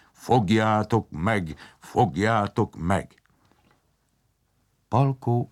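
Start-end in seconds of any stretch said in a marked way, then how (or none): 0.1-3.2 bellowing steam-man. A narrator's reading. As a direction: send the pursuers after him in a booming voice. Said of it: "A gőzemberke meg bömbölt". (elnyújtott, bömbölő parancs)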